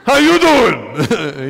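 loud, drawn-out Brooklyn greeting; Brooklyn monk. Retold or imitated. Comic mimicking the monk, exaggerated Brooklyn accent. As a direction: stretch out a big Brooklyn "how you doing". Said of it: "a Buddhist monk from Brooklyn".

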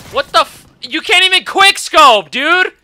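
loud, high frustrated rant; angry yelling. Real, not acted. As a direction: yell at the useless gun. Ranting at weak weapon.

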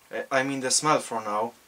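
talking while sniffing a jar; muffled behind held container; speaking into a jar. Real, not acted. Seen 0: Holds jar over nose and mouth, sniffing, while speaking.

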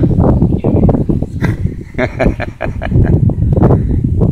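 breathless wheezy cackle, no voice; long wheezing laugh. Real, not acted. Taunting the spray plane overhead, wheezing laugh.

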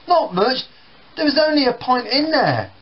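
put-on sulky kid. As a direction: answer back like a kid. Brothers quarrel in acted scene.

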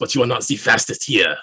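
put-on villain's line in a loose French accent; sort-of French accent. Game master voicing a character for players, sort-of French accent. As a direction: take a big swing at a French accent. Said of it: "sort of French".

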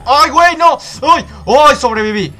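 loud, high yelps of alarm; startled panic. Real, not acted. Gamer narrowly surviving a jump, startled loud yelps. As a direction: yelp in alarm, then exclaim at surviving. Near death in the game.

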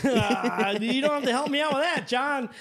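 high, forced neighborly chuckle; nervous polite laugh. Retold or imitated. Homeowner humoring John's trash joke, nervous polite laugh. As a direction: fake a laugh, then talk high through it. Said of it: "you're doing that nervous laugh with a neighbor".

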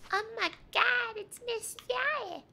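very high, drawn-out excited squeal; fangirl squeal. Real, not acted. Streamer gushing over Miss Yae in the game, high squeal. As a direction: squeal it like a starstruck fan, stretching the name. Same greeting repeated in a squeal.

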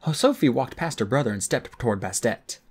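usual speaking voice

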